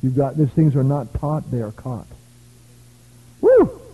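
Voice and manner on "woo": high loud preacher's whoop; joyful whoop. Real, not acted. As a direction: let out a happy shout.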